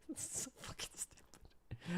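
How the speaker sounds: whispered aside. Real, not acted. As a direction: breathe it, no voice.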